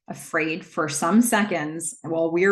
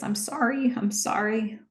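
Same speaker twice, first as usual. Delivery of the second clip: pleading apology; 5 semitones higher than usual. Retold or imitated. Mother apologising to her daughter, high and pleading. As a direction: raise the pitch and repeat it as a plea.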